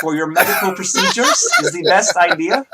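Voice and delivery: put-on high, loud, accented feminine voice; a nervous assistant questioning the doctor; vaguely feminine high voice. Assistant asking the doctor in a high, loud voice. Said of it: "And it sounds kind of like this".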